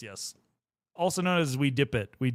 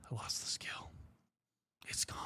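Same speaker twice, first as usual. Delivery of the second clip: a mock-tragic whisper; 16 dB quieter than usual. Streamer to chat, mourning her lost R-roll, whisper. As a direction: breathe it out as a joke lament.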